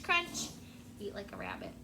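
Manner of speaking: plain reading voice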